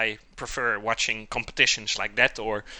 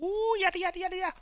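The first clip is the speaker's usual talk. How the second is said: singsong; 4 dB quieter, much faster than usual. Real, not acted. Singsong while taking out pie.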